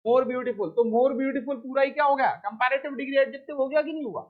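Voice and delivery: ordinary talk, nothing marked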